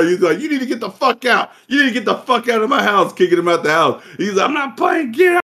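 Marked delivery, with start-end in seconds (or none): 0.4-3.1 kicked-out order. Retold or imitated. Grandfather kicking son out. 4.5-5.4 high, shouted final warning; imitated shouted order. Grandfather throwing Wendell out, mock shout.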